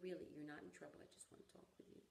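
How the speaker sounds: put-on calm a scared little girl; gentle reassurance. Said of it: "But he said".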